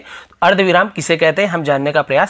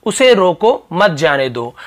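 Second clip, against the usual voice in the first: "उसे रोको" raised, short stop, "मत जाने दो" falling; pause after रोको; slower than usual. Shown as a demonstration. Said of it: "थोड़ा सा रुकना पड़ेगा हमें". (रोको के बाद अल्पविराम, फिर "मत जाने दो")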